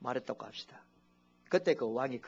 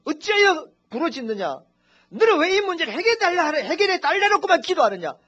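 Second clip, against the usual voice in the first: God's rebuke; 12 semitones higher, 9 dB louder than usual. Retold or imitated. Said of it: "근데 하나님은".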